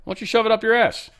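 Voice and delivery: hammed-up defiant retort; mock defiant Netanyahu. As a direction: tell them where to shove it, with mock swagger. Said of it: "And he's like".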